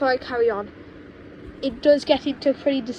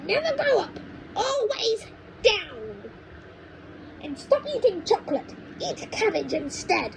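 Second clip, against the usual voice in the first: witchy grandma; 9 semitones higher than usual. Acted. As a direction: draw it out like a wicked old witch. Grandma voiced by reader.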